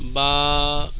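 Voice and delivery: با with the long aa held level; drawn-out letter. Shown as a demonstration. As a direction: stretch the vowel of با for two beats. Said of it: "ہلکا سا دو حرکات کے برابر کھینچ کے".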